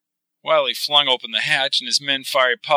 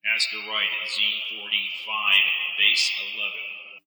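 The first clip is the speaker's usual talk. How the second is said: slow, softer machine voice; automated voice; 3 semitones lower, 7 dB quieter than usual. Acted. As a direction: read it like a computer. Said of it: "answered the automated voice".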